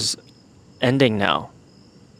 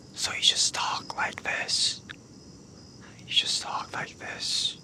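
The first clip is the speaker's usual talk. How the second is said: soft, voiceless whisper; hushed, airy whisper with no voice in it; 10 dB quieter than usual. Shown as a demonstration. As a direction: whisper it, demonstrating the technique. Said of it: "you have to whisper".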